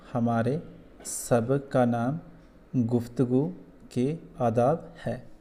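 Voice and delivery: each phrase read slowly, with pauses between; teacher modelling slow, careful reading; slow model reading. Shown as a demonstration. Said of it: "ऐसे इसको पढ़ना है".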